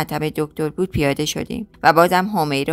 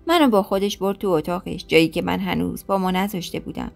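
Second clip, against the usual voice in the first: ordinary delivery, nothing marked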